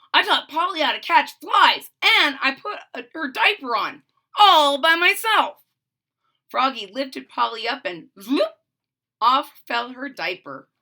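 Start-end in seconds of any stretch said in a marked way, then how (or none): 0.1-5.6 put-on louder, higher eager kid; proud brag. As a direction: show off to mom. Froggy brags to mom.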